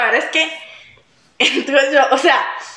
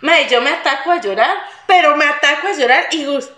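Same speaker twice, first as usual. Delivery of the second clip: ordinary talk, nothing marked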